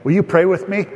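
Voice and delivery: ordinary delivery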